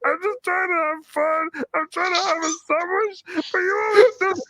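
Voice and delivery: squeaky high whine, far above own voice; whiny high voice. Retold or imitated. Voicing whiny self against Chucky.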